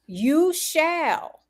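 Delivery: "shall" stressed on a high, falling pitch; emphatic SHALL. Acted. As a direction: lean firmly on "shall" as a promise. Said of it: "That means emphatically".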